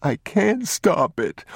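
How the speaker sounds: put-on very high, tearful child voice; sobbing. A narrator's reading. Boy told to stop answers his father, sobbing. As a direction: wail it slowly as a crying boy. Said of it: "he sobbed".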